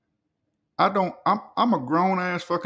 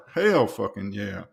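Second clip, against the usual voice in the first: ordinary delivery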